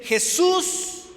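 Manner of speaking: grito alto y agudo; llama a Jesús a todo pulmón. Retold or imitated. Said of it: "Por eso él grita y dice". (el ciego grita el nombre de Jesús)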